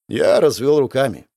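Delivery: ordinary delivery, nothing marked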